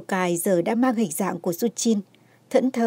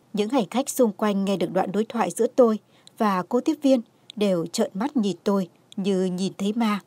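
ordinary delivery, nothing marked

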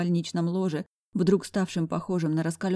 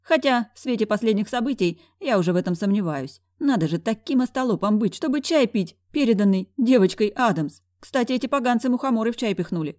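higher, animated mocking voice; sassy, exasperated chatter; brash best friend; 6 semitones higher, 4 dB louder than usual. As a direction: gossip incredulously to a friend.

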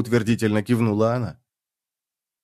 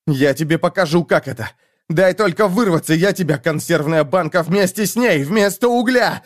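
angry threat; 6 semitones higher than usual. A narrator's reading. Bound man threatens his captor.

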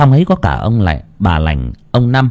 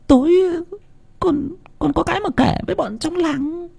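put-on high, soft child's voice; a village kid whispering plans; hushed high boy; boyish voice; 6 dB quieter than usual. Boy's voice in story.

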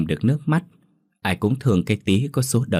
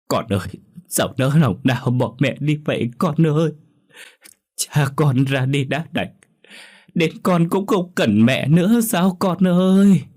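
tearful lament; 8 semitones higher, 4 dB louder than usual. Acted. Stepmother mourning over the body, tearful lament. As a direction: draw the words out in a rising wail. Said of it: "vẫn rấm rứt khóc".

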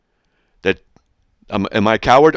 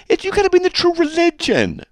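high put-on voice of a zealot; mocking cult member. Mocking what false groups say, in a high put-on voice. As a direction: parody a smug sect member. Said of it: "That's what false groups say".

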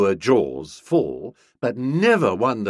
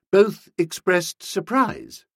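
ordinary delivery, nothing marked

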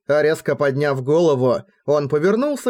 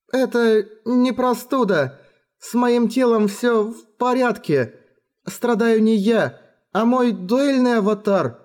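put-on weak girl voice; 7 semitones higher than usual. A narrator's reading. Rin voiced, weak and slow.

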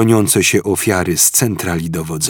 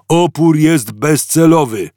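guard roaring; 8 semitones higher, 5 dB louder than usual. A narrator's reading. Guard to prisoners, loud roaring bark. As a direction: stress on Opór, loud bark. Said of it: "znów zaryczał".

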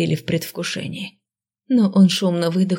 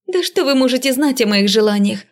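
heated, higher-pitched outburst; exploding; 6 semitones higher than usual. A narrator's reading. Heroine snapping back at the lord, exploding. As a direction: fire the question back, raised and indignant. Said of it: "взорвалась я".

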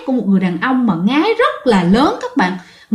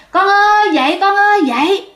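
put-on mother keeps calling her child up, raising her voice higher; calling out again; 9 semitones higher than usual. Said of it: "mẹ cứ kêu".

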